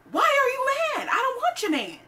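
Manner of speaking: high, loud exasperated "why are you mad"; incredulous outburst. Retold or imitated. Telling off the girlfriend, high and exasperated. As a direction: throw it back at her, disbelieving and loud. Quoting herself in the argument.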